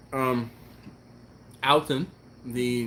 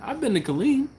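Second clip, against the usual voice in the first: usual speaking voice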